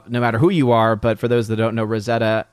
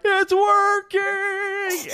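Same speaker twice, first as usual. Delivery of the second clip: very high, long drawn-out cry; Darth Vader actor; much slower than usual. Retold or imitated. Big guy playing Darth Vader in a clip, drawn-out high mock cry. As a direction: stretch each word out, pitched way up. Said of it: "guy who's playing Darth Vader".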